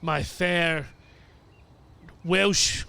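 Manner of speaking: lightly put-on Welsh accent. Complaining of the sun on fair skin, Welsh accent. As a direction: try a Welsh lilt, slowing with pauses. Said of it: "that's a right Welsh accent".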